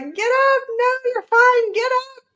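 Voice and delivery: very high, acted-out begging; desperate pleading. Reader urging a dying character on, high desperate plea. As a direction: plead as if willing someone back to life.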